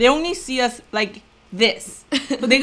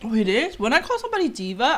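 usual speaking voice

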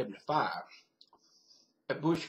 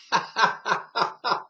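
laughing; 7 semitones higher, 6 dB louder than usual. Retold or imitated. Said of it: "I just burst out laughing".

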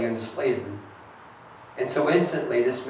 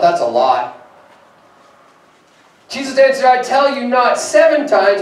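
higher, louder preacher's read; full-force preaching; 8 semitones higher, 6 dB louder than usual. Acted. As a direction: lift the scripture with pulpit energy.

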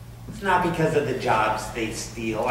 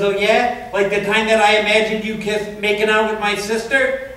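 higher, louder sarcastic comeback; mock wife retort; 6 semitones higher, 5 dB louder than usual. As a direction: throw it back as a sharp challenge. Wife's retort in retold fight.

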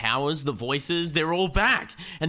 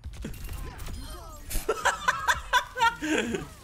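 long high laugh. Real, not acted. Laughing at his voice crack.

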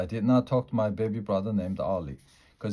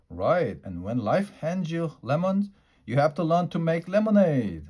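cheery dad; 7 semitones higher than usual. Acted. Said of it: "that man".

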